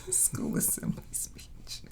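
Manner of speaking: breathless whispered laugh through the words; laughing too hard to speak. Real, not acted. Cohost repeating the phrase that tickled her, laughing breathlessly. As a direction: wheeze the words out softly, no voice left.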